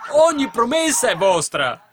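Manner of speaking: high, loud, drawn-out preaching cry; fervent proclamation. Real, not acted. Preacher promising the congregation every promise is theirs, fervent proclamation. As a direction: proclaim it at full force, slowly.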